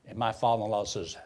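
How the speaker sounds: ordinary talk, nothing marked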